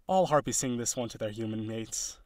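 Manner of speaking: usual speaking voice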